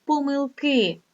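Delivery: помилки with a high, stressed final И; stress on last syllable. Shown as a demonstration. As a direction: stretch the КИ of помилки. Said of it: "наголос іде тільки на останню голосну".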